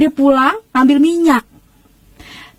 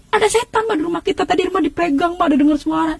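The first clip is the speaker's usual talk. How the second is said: panicked pleading; 6 semitones higher than usual. Retold or imitated. Panicked child pleading with mother.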